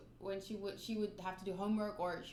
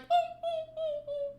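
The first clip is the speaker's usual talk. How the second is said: high whimpering dog noises, much higher; 7 dB louder, slower than usual. Retold or imitated. Narrator mimicking the frightened dog's whimper. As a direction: imitate a scared dog whining as it retreats. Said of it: "started whimpering".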